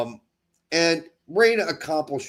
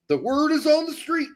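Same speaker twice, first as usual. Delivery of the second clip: mocking quote; 9 semitones higher than usual. Mocking the pundit's phrase again.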